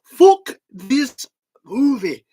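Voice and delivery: slow, loud, high Belfast outrage; exaggerated Belfast cry of disgust; Belfast accent. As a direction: drag out each word in mock Belfast fury. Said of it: "he just kept saying".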